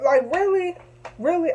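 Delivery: high, disbelieving, almost squeaking "really"; can't believe what she's hearing; incredulous outrage. Real, not acted.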